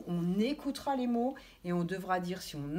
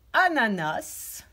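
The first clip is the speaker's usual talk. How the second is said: «ananas» drawn out slowly; slow articulation; 4 dB louder, much slower than usual. Shown as a demonstration. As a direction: stretch «ananas» to hear the A sounds. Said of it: "il faut dire le mot et il faut l'écouter". («ananas» très lentement)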